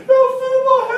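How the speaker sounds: high, loud mock wail of grief; wailing mother. A preacher acting a grieving mother's wail. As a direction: cry it out like a mother falling apart. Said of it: "começava a chorar ali".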